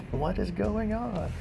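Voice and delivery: high, slow, soft baffled voice; mock Pharisee. Pharisees watching the parade, high slow bafflement. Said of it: "the Pharisees going".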